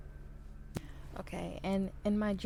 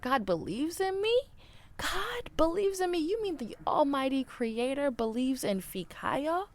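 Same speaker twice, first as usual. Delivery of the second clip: rising high, incredulous; can you believe it, lifting ever higher; overwhelmed that the Creator believes in her, pitch soaring; amazed disbelief; 7 semitones higher than usual. Real, not acted. Marvelling at the quote.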